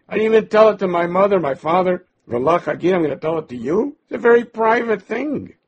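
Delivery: husband snapping back at his nagging wife, exasperated; exasperated retort. Retold or imitated. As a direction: fire it back at her in disbelief.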